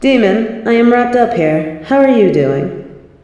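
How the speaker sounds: calling out. Acted. Calling out across the area.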